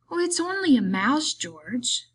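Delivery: put-on high, childlike pleading that drops on the name; pleading simpleton. A narrator's reading. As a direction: confess it like a child begging to keep it. Simpleton confesses to his friend.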